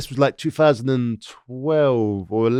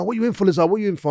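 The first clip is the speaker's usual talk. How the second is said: lightly voiced, higher-pitched questioners; imitated nosy inmates; 7 semitones higher, faster than usual. Inmates ask the newcomer about his charge, nosy. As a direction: pester him in a high mock voice.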